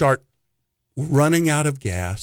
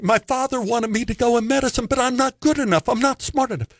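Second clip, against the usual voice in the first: fast, rising higher and higher as the babble builds; nervous rambling that climbs ever higher in pitch; talking a mile a minute; 5 semitones higher, faster than usual. Retold or imitated. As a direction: rattle it off fast, voice climbing with the panic. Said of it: "I was talking a million miles a minute".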